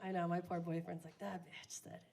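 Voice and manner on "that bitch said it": much softer, lower mutter; stunned mutter. Retold or imitated. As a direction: mutter it in disbelief. Boyfriend murmurs, much softer.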